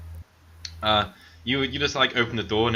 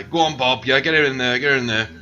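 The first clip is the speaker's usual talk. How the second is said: louder, higher, cheering on a driver; loud urging; 5 semitones higher, 7 dB louder than usual. Acted. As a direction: egg Bob on loudly. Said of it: "drives it in and goes".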